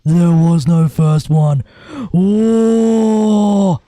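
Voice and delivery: A's denial. Retold or imitated. Streamer acting A denying the first puppet, slow and loud. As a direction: drag it out big, then a loud realising oh. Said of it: "And Aid will be like".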